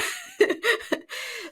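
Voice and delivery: a high embarrassed giggle; real sheepish laugh. Real, not acted. Laughs at Backstreet Boys fan.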